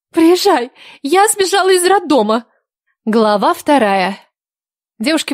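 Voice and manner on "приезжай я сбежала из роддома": higher, urgent call; shouting. Acted. Vika shouts to her friend on the phone. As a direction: cry her friend's name down the line.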